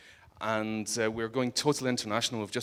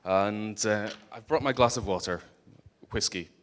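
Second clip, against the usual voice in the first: ordinary delivery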